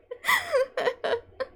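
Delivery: high wheezing cackle of disbelief; real fit of laughter; helpless laughter. Real, not acted. Streamer cracks up at dialogue.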